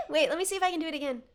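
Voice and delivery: ordinary talk, nothing marked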